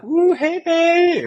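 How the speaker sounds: very high-pitched mock catcall; high falsetto come-on; flirty women catcalling. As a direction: squeal it out like women calling from a car. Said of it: "they're just like".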